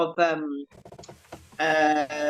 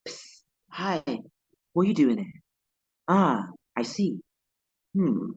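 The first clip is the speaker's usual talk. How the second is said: low, slow and secretive; furtive British clique member; 5 semitones lower than usual. Acted. Sizing up a newcomer in this country, low and furtive. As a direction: sound someone out discreetly, voice dropped. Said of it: "Whilst in this country, it's more like".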